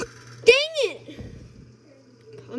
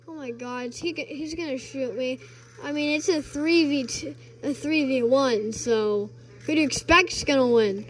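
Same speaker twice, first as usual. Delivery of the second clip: normal talking voice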